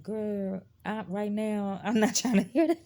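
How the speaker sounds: put-on loud, high, dismissive Girl with a laugh; sassy brush-off. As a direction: wave her off, half laughing. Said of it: "I'd just be looking at her like".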